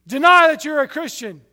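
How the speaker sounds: captors' demand. Retold or imitated. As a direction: voice the gunmen ordering him to deny. Captors order him to deny faith.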